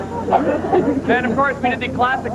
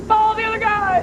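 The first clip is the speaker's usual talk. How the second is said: yelling back; 9 semitones higher than usual. Retold or imitated. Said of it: "And I'm yelling back to people".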